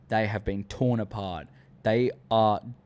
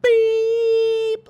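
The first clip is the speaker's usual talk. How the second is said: mock beep; 8 dB louder than usual. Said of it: "I'm like".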